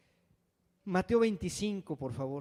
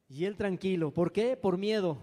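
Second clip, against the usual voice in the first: ordinary talk, nothing marked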